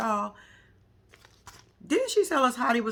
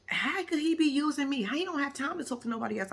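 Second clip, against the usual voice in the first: lightly mimicked, higher-pitched protest; mock her indignant question with a raised pitch; delusional Ty; 6 semitones higher than usual. Mocking Ty's reply to Boston on the phone, lightly imitated. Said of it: "delusional ass Ty was like".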